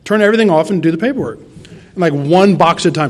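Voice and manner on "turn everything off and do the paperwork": quick, high, bossy command; bossy daughter. Retold or imitated. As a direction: order him to focus. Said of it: "she's like".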